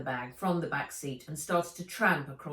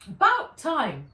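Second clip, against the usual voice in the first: high, loud grumble; impatient Fred; 9 semitones higher, 9 dB louder than usual. A narrator's reading.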